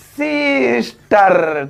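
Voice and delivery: very slow sister with the स sound held long; drawn-out teaching demo; slow motion. Shown as a demonstration. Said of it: "देखेंगे".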